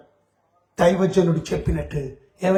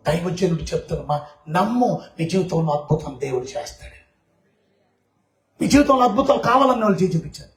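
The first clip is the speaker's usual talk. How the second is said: ordinary delivery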